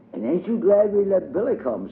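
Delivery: high little girl's voice, playing hostess; child voice for Diddy. A narrator's reading. Diddy greets her playmate at the door, high childlike voice. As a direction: lift into a small girl's prim voice.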